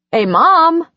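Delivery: calling out. Acted. Son calls for his mother through the house, loud. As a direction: shout for Mom from the doorway. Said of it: "Noel called into the house".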